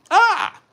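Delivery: high-pitched "ah" exclamation; Danhausen-like cry. Real, not acted. Streamer hearing the riddle's answer, high comic cry. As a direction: let out a sudden high "ah". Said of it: "Why did I sound like Danhausen?".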